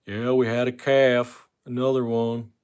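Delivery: slow, lightly put-on country voice; folksy farmer voice. Streamer voicing a farmer on the call, slow mild put-on voice. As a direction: drawl it like a farmer. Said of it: "I like doing the voices".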